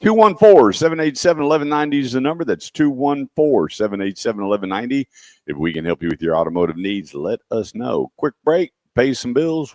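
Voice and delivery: punchy announcer read of the number; upbeat host reading a sponsor's phone number before the break; radio ad read. Acted. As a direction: sell it like a radio spot.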